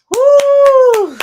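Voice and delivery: loud high woo of relief; joyful whoop. Real, not acted. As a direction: let out a big happy whoop. Celebrates making her sales number.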